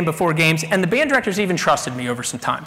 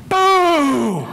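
booing; 6 semitones higher than usual. Retold or imitated. Crowd booing the mascot.

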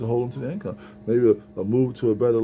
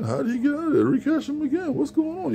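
much higher, bewildered voice of a caught escapee; confused escapee. Retold or imitated. An escaped prisoner, just recaptured, asks what's going on, baffled and much higher. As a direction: squeak out the confusion of a man recaptured.

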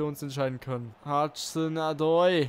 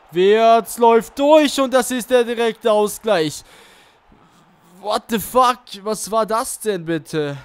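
loud, high disbelief; shocked outburst; 3 semitones higher, 9 dB louder than usual. Real, not acted. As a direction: blurt it out in shock. Conceding a sudden equaliser.

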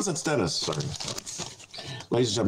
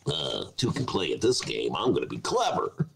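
mocking lower, softer voice; 11 semitones lower, 3 dB quieter than usual. Mocking Marjorie Taylor Greene.

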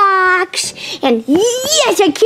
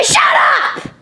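snapping; 9 semitones higher than usual. Acted. Sibling snaps during happy chant.

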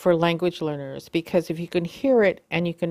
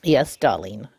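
lower-pitched, playful "yes, darling"; playful reply; 6 semitones lower than usual. Acted. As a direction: humour the machine in a lowered voice. Playful reply to the talking device.

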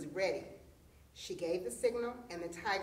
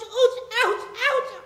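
high, loud yelps of ouch; yelling in pain; 13 semitones higher, 12 dB louder, slower than usual. A narrator's reading. Tiger dragged through thorn bushes, yelling in pain. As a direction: yell each ouch much higher and louder.

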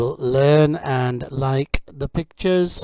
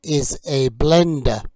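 slow, loud, carefully clear teacher drill; vocabulary drill; 4 semitones higher, 5 dB louder, slower than usual. Acted. Teacher drilling kitchen words for learners, slow and clear. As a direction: model each word for learners to repeat.

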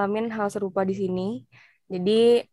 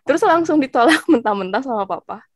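normal talking voice

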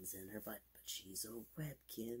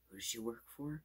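playful tease; 7 semitones higher, 10 dB louder, faster than usual. Acted. Playful teasing punchline.

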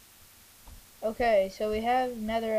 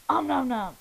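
nom nom chomping; 9 dB louder than usual. Pretend. Eats game cake.

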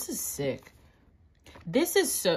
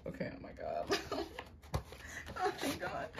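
breathless whisper; 11 semitones higher, 9 dB quieter than usual. Real, not acted. Stunned by card pull.

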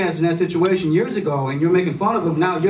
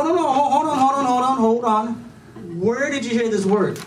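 higher, hurried hold on; alarmed dad; 8 semitones higher than usual. Retold or imitated. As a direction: stop her in a panicked high voice. Dad stopping daughter.

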